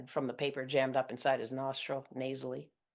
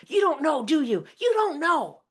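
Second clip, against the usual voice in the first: high, loud shriek; mom's shriek; 14 semitones higher, 11 dB louder than usual. Retold or imitated. Mother shrieking at her daughter over the phone. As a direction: shriek the question hysterically. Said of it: "my mom shrieks".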